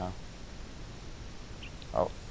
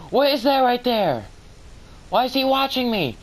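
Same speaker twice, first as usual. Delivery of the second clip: spooked; 10 semitones higher than usual. Real, not acted. Spooked by skeleton.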